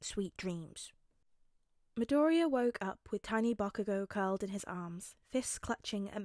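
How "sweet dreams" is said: soft, lower bedtime murmur; quiet. A narrator's reading. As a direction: wish them sleep softly and low. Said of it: "he said quietly".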